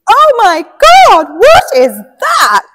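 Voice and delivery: loud, high, drawn-out shriek of horror; retold scream. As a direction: imitate a shocked schoolgirl screaming out, stretching each word. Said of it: "she actually screamed".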